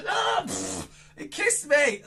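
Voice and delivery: ordinary delivery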